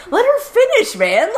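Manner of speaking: high-pitched protest, dropping on the last word; mock exasperation. Viewer protests the interruption on a character's behalf, exasperated. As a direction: plead in a squeaky, put-upon way.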